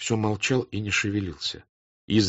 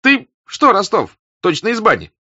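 surprised question; 13 semitones higher, 7 dB louder than usual. A narrator's reading. Comrade hails flushed Rostov.

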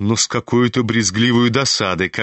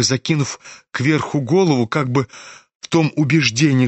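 usual speaking voice

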